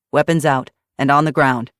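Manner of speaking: loud, firm command at gunpoint; hard loud order to disarm; barked order. Acted. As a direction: order them to drop their weapons. Eli orders them to disarm.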